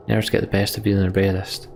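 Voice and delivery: usual speaking voice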